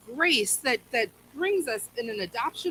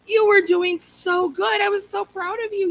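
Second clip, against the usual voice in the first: emphatic praise; 8 semitones higher, 4 dB louder than usual. Pretend. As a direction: lean in hard on how well they did. Paraphrasing Paul to the Galatians.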